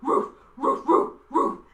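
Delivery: loud barking dog; playful loud woofs; big dog bark. Shown as a demonstration. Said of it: "loud dogs with a big bark".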